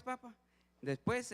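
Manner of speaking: mock child voice; child asking. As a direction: pitch up for the curious little boy.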